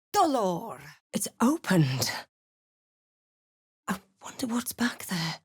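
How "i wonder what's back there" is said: soft curious whisper. Acted. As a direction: breathe the words, barely voiced. Curious aside at hidden passage.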